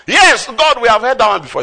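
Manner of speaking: loud, high, impatient; eager reply. Acted. Disciples interrupting God, eager and loud. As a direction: cut in eagerly.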